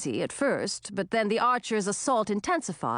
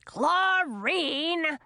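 shouting; 6 semitones higher than usual. A narrator's reading. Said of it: "shouted Ratinki".

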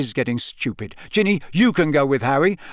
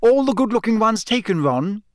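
high, superior tone; Hermione, lofty; 9 semitones higher, 3 dB louder than usual. A narrator's reading. Hermione teasing Ron about girls, lofty. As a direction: look down on him from a height. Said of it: "said Hermione loftily".

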